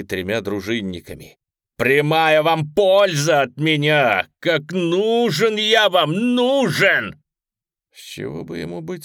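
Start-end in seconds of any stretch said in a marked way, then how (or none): 1.8-7.2 high, loud and slow, pleading hard; desperate loud insistence. Acted. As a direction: shout it, begging to be believed. Prisoner pleading loudly, insisting.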